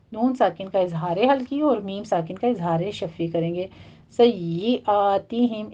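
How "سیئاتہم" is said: سیئاتہم very slow with a stressed doubled ya; tajweed demo. Shown as a demonstration. Teacher demonstrating the shadda to students, slow. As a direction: press the doubled ya in سیئاتہم slowly. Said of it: "یہاں کی تشدید ادا کیجیے".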